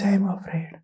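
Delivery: barely voiced, scared little whisper; frightened whisper. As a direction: whisper it like a terrified patient. Shivering patient answers the nurse.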